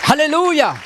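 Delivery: high-pitched hallelujah to the congregation; preacher's high, ringing call; rallying call. Real, not acted. Preacher calls congregation for response.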